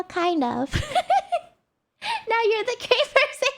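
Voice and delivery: ordinary delivery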